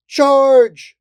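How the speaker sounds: high, very loud held shout; battle cry. A narrator's reading. Fairy leader launching a charge, battle cry. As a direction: send the troops charging.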